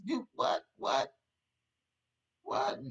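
squeaky high what? what?; mock baffled what. Preacher mimicking the world, baffled and high. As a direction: play the puzzled crowd, voice jumping up.

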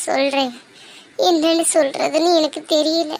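usual speaking voice

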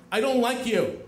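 high, loud retold outburst; indignant yell. Confronting the girl across the diner, indignant yell. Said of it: "screaming at her".

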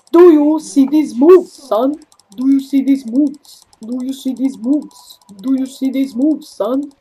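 loud, cocky taunt repeated; taunting brag. Real, not acted. Gamer taunting the enemy over his moves, cocky. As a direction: show off, rubbing it in.

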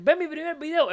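high mock plea to watch a first video; high, sing-song mock of a new YouTuber; mocking newbie YouTuber. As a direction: parody an eager beginner begging for views. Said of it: "la gente que siempre dice".

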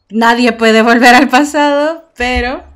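proclamación a todo volumen, lenta; grito dramático que satura el micrófono; gritando al micrófono. Acted. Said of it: "siento que les estoy gritando". (grita al público del stream, a todo pulmón)

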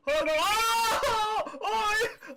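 high, loud panicked shriek; panicked yell. Real, not acted. Streamer shrieking as the puppy wrecks the room.

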